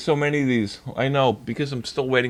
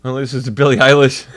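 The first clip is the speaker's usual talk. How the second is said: mock clueless girl; 9 dB louder than usual. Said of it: "and she says".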